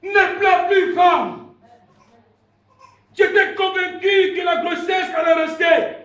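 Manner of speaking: loud, high-pitched sermon cry; full-force preaching. Real, not acted. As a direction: proclaim it to the whole church. (prédication enflammée, criée)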